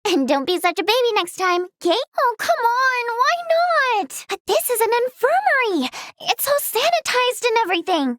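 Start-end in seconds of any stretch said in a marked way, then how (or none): 2.2-4.2 high, drawn-out protest; pleading whine. Acted. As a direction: whine at being turned down. Begging after being refused.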